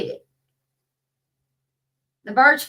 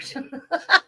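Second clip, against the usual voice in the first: laughing; 4 semitones higher than usual. Real, not acted. Laughing at her cat story.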